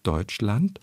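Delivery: Deutschland with a late rise carried onto the second syllable; Angela Merkel. Retold or imitated. Voice expert imitating chancellor's word stress, Deutschland rising late. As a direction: pitch climbs late through Deutschland, stress left ambiguous. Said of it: "ganz gerne etwa in der Art".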